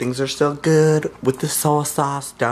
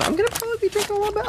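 high-pitched aside; much faster than usual. Acted. Sudden high fast aside.

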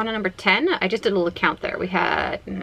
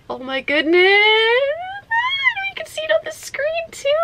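overwhelmed delight. Real, not acted. Player showing viewers her shiny, overwhelmed delight. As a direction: let it climb into a squealing wail of joy.

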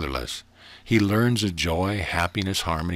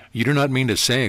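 higher, louder exclamation; raised-voice incredulous challenge; exclaiming; 6 semitones higher, 5 dB louder than usual. A narrator's reading. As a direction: exclaim it in disbelief, pitched up. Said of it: "exclaimed the minister".

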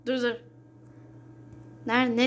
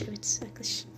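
whisper; 3 semitones lower, 10 dB quieter than usual. Real, not acted. Whispering to viewers mid-game.

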